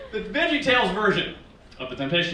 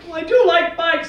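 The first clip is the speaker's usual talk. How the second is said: put-on high, louder little-kid voice; eager childlike squeak; child Jesus; 11 semitones higher, 7 dB louder than usual. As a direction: give in like a tempted child. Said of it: "And Jesus is like".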